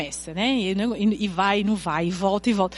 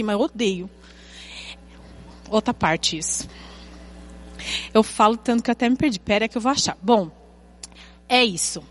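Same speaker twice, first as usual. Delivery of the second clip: ordinary talk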